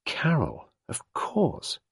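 put-on a flustered young woman confessing; embarrassed young woman recalling; Sarah, young woman. A narrator's reading. Sarah reacting.

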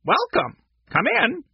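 high parrot voice; short high bird-like calls; parrot squawk. A narrator's reading. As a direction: squawk it like a pet-shop parrot. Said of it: "parrot squawked".

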